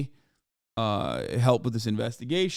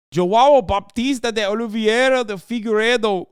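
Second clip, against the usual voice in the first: name read higher and louder in a Brazilian accent, laughing through it; 9 semitones higher, 7 dB louder than usual. Shown as a demonstration. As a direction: try a Brazilian pronunciation of the name, cracking up. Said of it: "I'm not very good at Brazilian accents".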